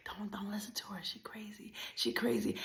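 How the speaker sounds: very hushed warning aside; urgent, barely voiced aside; secretive whisper. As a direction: whisper it behind her back. Secret aside to bus rider.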